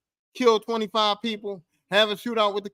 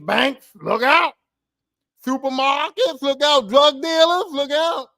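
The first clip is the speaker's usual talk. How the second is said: menacing warning; 4 semitones higher, 6 dB louder, slower than usual. Pretend. Villain threatening banks.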